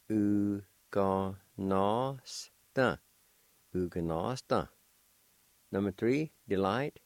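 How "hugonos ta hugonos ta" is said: "Hugonos-ta" as u-ga-nos-ta, stress steady; Cherokee pronunciation demo. Shown as a demonstration. Teacher models Cherokee word for delicious, carefully. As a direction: sound out "Hugonos-ta" clearly for learners. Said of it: "it's pronounced like this".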